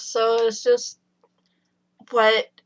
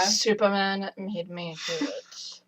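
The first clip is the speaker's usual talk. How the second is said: deadpan excuse; 9 dB quieter, slower than usual. Retold or imitated. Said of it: "do it with a straight face".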